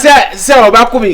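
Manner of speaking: loud, high, slow angry yell; furious shout. Acted. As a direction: bellow that he will kill him. Man raging at a wife's lover.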